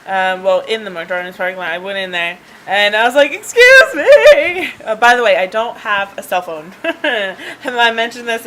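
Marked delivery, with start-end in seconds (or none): 3.3-4.7 loud, high, drawn-out tearful wail; mock crying. Crying driver stopping women in a parking lot, mock wail. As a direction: call out in hammed-up sobs. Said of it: "I just start crying".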